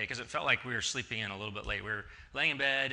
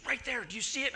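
higher voice, pressing him to look; urgent insisting wife; 11 semitones higher than usual. Retold or imitated. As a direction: make him see it, urgent.

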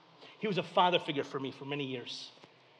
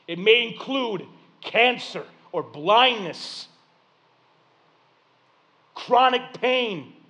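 impassioned preaching; 7 semitones higher, 10 dB louder than usual. Real, not acted. Preaching on suffering, voice raised.

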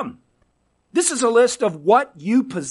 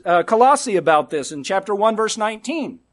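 ordinary delivery

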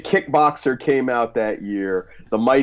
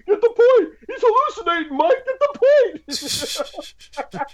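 movie coach impression; faster than usual. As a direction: shout it like a frantic coach. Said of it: "he's like".

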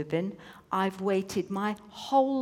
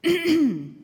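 higher, short throat clear; 8 semitones higher, 5 dB louder than usual. A narrator's reading. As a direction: clear the throat before a speech. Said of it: "The angel coughed".

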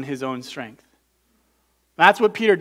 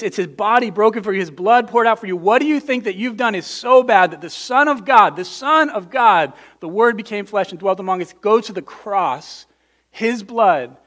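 louder, higher fervent sermon voice; rising passionate preacher; impassioned preaching; 4 semitones higher, 5 dB louder than usual. Acted. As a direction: preach it with urgency. Building preaching climax.

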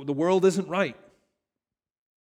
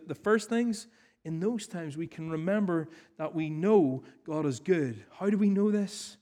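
raised, urgent pulpit appeal; fervent preaching; 5 semitones higher than usual. Acted. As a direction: press the point home to the congregation. Preaching at full force.

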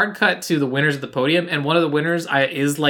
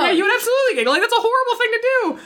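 shocked; much faster than usual. Real, not acted. Horrified reaction.

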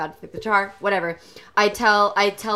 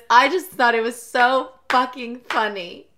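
ordinary talk, nothing marked

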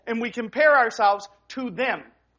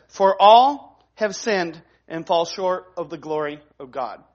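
slow emphatic reading; 3 semitones lower, 3 dB louder than usual. Shown as a demonstration. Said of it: "And it says simply this".

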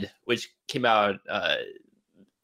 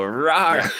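short mock zombie growl, higher and a bit louder; mock zombie rah; 5 semitones higher, 4 dB louder than usual. Mocking movie zombies that pop up, a short playful growl. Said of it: "They just kind of pop up and go".

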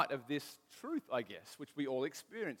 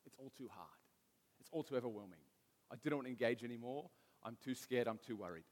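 overwhelmed and defeated; 5 semitones lower, 8 dB quieter than usual. Acted. Voices wanting to hide from world.

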